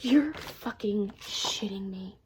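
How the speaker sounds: slow, disbelieving whisper; stunned whisper. Real, not acted. Reader finding a signed front page, stunned whisper. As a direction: whisper it in shock, slowly.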